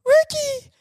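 put-on very high, loud girly shout; girl scream. Friend yelling for Ricky to come in, very high loud shout. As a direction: shriek his name from inside. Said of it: "I just hear a girl scream".

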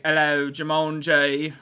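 slow imitation of a deep British voice; lightly imitated British accent. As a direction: greet slowly as a deep-voiced British man. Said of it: "he had the deep British voice".

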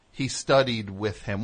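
usual speaking voice

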